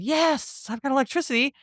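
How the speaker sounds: high, delighted cry; lightly hammed-up triumph; mock excited. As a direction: squeal with glee at the power. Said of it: "He's all excited".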